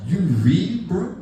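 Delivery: put-on loud, disbelieving young guy; mock young coworker asking, loud and amazed; incredulous young coworker. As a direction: scoff at the idea of reading. Young coworker mocking him.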